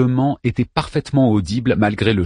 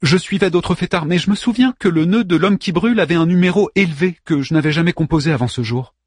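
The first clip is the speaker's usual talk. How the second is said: light woman's voice; 8 semitones higher than usual. Acted. Anna voiced higher.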